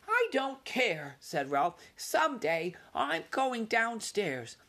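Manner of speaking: slow, high, stubborn mouse voice; defiant young mouse. A narrator's reading. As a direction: dig in like a stubborn kid. Ralph defiant.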